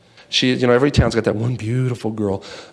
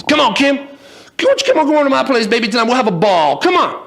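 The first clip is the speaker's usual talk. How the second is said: pitched way up, calling out to the town beauty; hollering at a girl; 11 semitones higher than usual. Acted. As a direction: holler after her like a lovestruck cowboy. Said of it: "he starts to holler at this girl".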